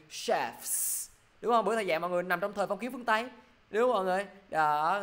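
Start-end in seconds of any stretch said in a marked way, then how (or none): 0.1-1.1 CHEFS as "shefs" with f then s; model pronunciation. Shown as a demonstration. Teacher modelling the plural, CHEFS with a long fs ending. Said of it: "Thầy sẽ đọc gì?".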